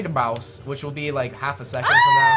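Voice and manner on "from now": sudden yelling at the screen; panicked shout. Real, not acted. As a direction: yell in real panic. Fly attack panic.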